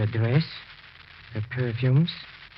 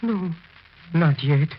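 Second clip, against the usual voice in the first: loud, higher urgent begging; desperate plea; 5 semitones higher, 7 dB louder than usual. Acted. Husband begging his wife's ghost for one more moment, loud. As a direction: hold her back with the voice.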